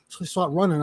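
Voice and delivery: normal talking voice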